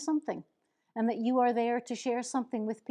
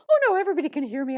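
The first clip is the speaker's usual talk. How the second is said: dismissive; 6 semitones higher, 7 dB louder, much faster than usual. Retold or imitated. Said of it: "don't just go".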